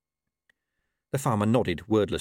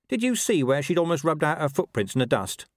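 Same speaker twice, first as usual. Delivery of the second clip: lightly voiced talking dog, raised pitch; Gaspode; 6 semitones higher than usual. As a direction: ask as the sharp-eyed dog.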